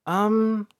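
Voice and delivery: high, hesitant little um; sheepish. A narrator's reading. Princess steps out from behind the couch, sheepishly. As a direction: pipe up sheepishly. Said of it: "April stepped forward sheepishly".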